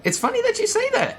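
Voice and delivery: very high, loud tour-guide voice; proudly showcase the town in a strained falsetto; high-pitched, over-eager town greeter; Cap. Acted. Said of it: "let's see if I can do his voice".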